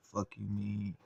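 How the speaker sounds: ordinary talk, nothing marked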